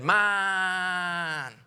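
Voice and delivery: the frog can't help claiming credit; proud frog. Acted. Frog answering the farmer in the punchline, high and drawn out.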